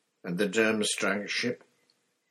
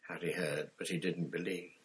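normal talking voice

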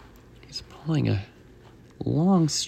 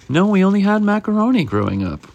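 inspector voiced higher and louder; lightly put-on higher inspector; 9 semitones higher, 5 dB louder than usual. Inspector answering chef about spaghetti, higher put-on voice. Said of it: "Inspector says".

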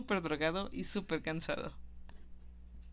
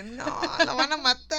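higher, louder panicked warning; mock alarm; 6 semitones higher, 5 dB louder than usual. Friend mimics a shocked viewer, mock alarm. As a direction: yelp it like a worried onlooker. Dalton relaxed, killers coming.

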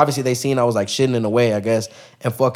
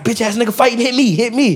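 mock homie yelling; 9 semitones higher than usual. Homie's quote charging at fighters.

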